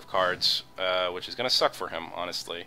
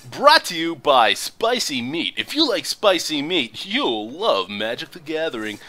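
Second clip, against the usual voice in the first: booming radio announcer; 5 semitones higher, 6 dB louder than usual. Acted. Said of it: "what you just witnessed there was my, uh, radio voice".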